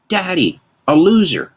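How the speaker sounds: put-on high little girl's voice, pointing it out; toddler voice. Toddler daughter calling to her dad in the store, high and chirpy. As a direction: chirp it like a two-year-old. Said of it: "my daughter goes".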